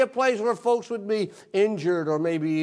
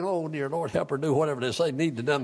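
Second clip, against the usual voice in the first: a soft, hushed prayer under the breath; whispered prayer; 5 semitones lower, 6 dB quieter than usual. Retold or imitated. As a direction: pray quietly and quickly to yourself. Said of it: "I was saying".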